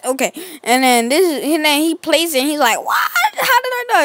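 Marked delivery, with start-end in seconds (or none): 2.8-4.0 put-on a kid's shocked what-just-happened; mock baffled high cry; bewildered squeak. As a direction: jump way up in pitch on the question. Ronald confused by losing the game.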